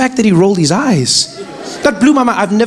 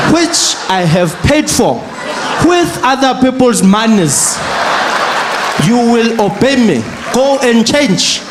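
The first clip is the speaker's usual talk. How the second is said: stern father; slower than usual. Retold or imitated. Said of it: "father laying down law".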